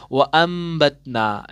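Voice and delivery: "व अम्बतना" slowly, m hummed through the nose; iqlab with nasal m. Shown as a demonstration. Teacher demonstrates the correct reading, nasal m drawn out. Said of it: "किस तरीके से पढ़ना है?".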